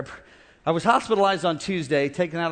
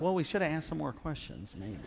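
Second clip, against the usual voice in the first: sheepish aside; 2 semitones lower, 9 dB quieter than usual. Retold or imitated. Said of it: "I'm like".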